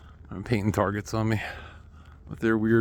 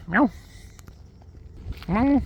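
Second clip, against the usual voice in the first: meow; 12 semitones higher than usual. Real, not acted. Meowing back at the cat.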